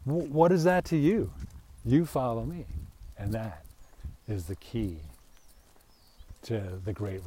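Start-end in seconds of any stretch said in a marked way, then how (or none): 0.0-2.7 a touch of a stern Jesus, a bit louder; lightly voiced Jesus rebuke; Jesus rebuking Peter. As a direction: snap the question back at Peter. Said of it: "Jesus looked at Peter and goes".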